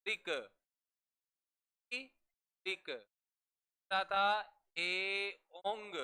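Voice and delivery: drawn-out sutra recital; slow recital. Shown as a demonstration. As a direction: chant each syllable slowly. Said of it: "का सूत्र था".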